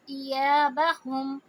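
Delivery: ایابہم very slow, یا held two counts; Quran recitation. Shown as a demonstration. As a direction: stretch the یا in ایابہم. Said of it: "یا کو دو حرکہ". (ایابہم میں یا کو دو حرکہ لمبا کریں)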